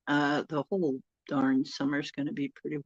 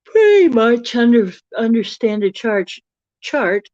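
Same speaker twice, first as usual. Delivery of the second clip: talking through a yawn; words stretched by a yawn; 5 semitones higher, 11 dB louder than usual. Real, not acted. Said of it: "pardon me for yawning".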